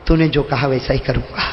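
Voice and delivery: low, subdued reply through tears; understated weeping, voice dropped low; tearful. A narrator's reading. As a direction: answer softly and low, holding back tears. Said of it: "روتے روتے فرمایا".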